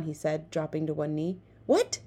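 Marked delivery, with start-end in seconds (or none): 1.7-2.0 high startled what; surprised. A narrator's reading. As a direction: jolt of surprise. Friend startled.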